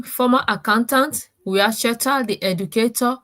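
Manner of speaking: normal talking voice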